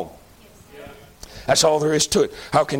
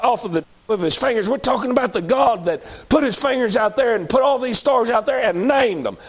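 higher, rising sermon cadence; full-force preaching; 4 semitones higher than usual. Acted. Preacher exalting God to the congregation, rising fervor. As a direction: preach it up, building with each line.

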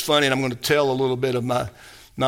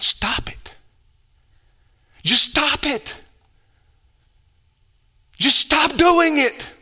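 blunt command building from hushed to high and emphatic; Tim Conway's psychologist; 11 semitones higher, slower than usual. Acted. Psychologist giving a patient his only advice, building exasperated command. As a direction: escalate each order, slowing on the last. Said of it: "Tim Conway played a psychologist".

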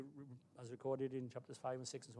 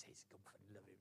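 normal talking voice

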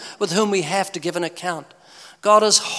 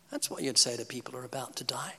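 breathy hushed whisper, fast; 5 semitones lower, 12 dB quieter, faster than usual. Shown as a demonstration. Preacher repeating his point to the crowd, whispered. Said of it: "unvoiced whisper".

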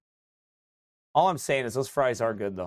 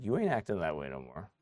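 voice of God; 9 semitones lower, 8 dB quieter than usual. Acted. God's voice quoted to believer.

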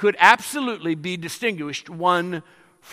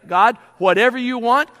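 higher and louder, stepping forward; eager prayer; 6 semitones higher, 5 dB louder than usual. Retold or imitated. Believer answering God's call, eager and loud. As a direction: pray it like you mean to leap in. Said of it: "where you will say".

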